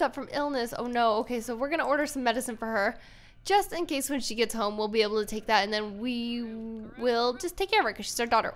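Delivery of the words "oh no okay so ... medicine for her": normal talking voice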